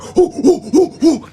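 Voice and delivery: high, loud mock panting hoos; imitating a dog barreling at him. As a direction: huff like a charging dog. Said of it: "It's like".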